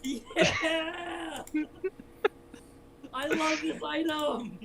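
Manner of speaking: high, drawn-out happy squeal; gleeful delight. Real, not acted. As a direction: gloat with real glee, stretching it out. Delighted at the item received.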